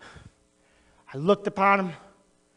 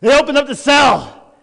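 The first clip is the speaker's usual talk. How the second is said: usual speaking voice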